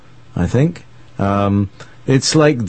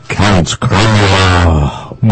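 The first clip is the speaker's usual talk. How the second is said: long drawn-out, dramatic name introduction; loud theatrical villain's announcement; hammed-up announcer; 3 semitones lower, 5 dB louder, much slower than usual. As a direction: draw the name out hugely, mock-ominous.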